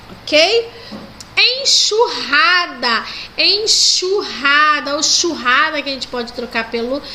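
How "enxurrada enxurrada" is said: enxurrada: x als sj, traag; slow model word. Shown as a demonstration. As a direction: enxurrada stretched with sh. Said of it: "seria com C H".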